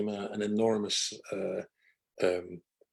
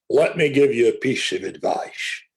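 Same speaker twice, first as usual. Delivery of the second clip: put-on loud, emphatic northern English accent; Ray Hill's northern accent; 6 dB louder than usual. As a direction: announce it forcefully in a northern accent. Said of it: "in his very northern accent".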